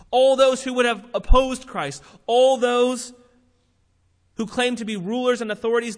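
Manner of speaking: rally the congregation; forceful preaching. Acted. Preaching peak, louder.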